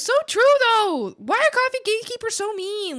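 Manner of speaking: loud delighted agreement; emphatic agreement. Real, not acted.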